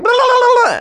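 very high, loud blah-blah; monkey startling the tiger; monkey taunting. Acted. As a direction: blast it like a cheeky monkey. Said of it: "a big monkey going".

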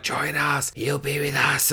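soft eerie murmur; ghostly voices. Retold or imitated. Ghosts luring the girl in the dark, soft eerie murmur. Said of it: "All these voices".

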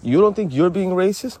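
normal talking voice

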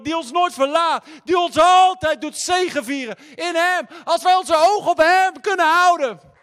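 preaching at full force. Acted. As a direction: build the sermon into a roaring crescendo.

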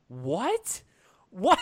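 baffled question, rising sharply; mock bewilderment. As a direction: let it rise in disbelief, pause between each. Acting out baffled reaction to film.

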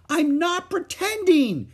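put-on very high, loud, slow child's scream. A narrator's reading. Little girl screaming at her big sister, protesting. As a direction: wail it out in protest. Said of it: "screamed Ramona".

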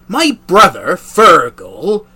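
hearty friendly stranger; Fergal voice. Acted. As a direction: proudly announce the brother. Fergal voice, loud.

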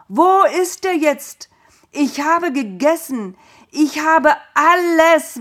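high, loud, slowed horrified scream. A narrator's reading. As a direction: cry out in shock at the deception. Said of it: "Isaak schrie".

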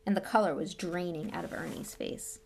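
ordinary delivery, nothing marked

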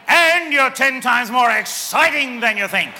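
excited declaration. Shown as a demonstration. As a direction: proclaim it like big news. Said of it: "Turn to the person next to you and say".

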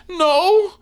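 sharp high loud no; high outraged no. Retold or imitated. Father forbidding the trash, loud high no. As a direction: shout the no up high. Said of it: "the pitch in the voice elevates".

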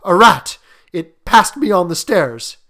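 put-on loud, frightened excuse from an old man; rattled and insistent; shaking tones. A narrator's reading. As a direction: raise the voice in alarm. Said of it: "said the old man in shaking tones".

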